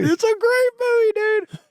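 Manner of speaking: high, loud gush; high excited enthusing. Real, not acted. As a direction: rave about a favorite movie. Enthusing about Cool Runnings.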